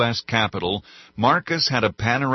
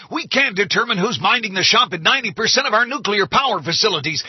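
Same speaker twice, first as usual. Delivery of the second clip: much higher, loud, fast tirade; furious rant; 11 semitones higher, 4 dB louder than usual. A narrator's reading. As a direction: rage at the room. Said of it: "the president barked".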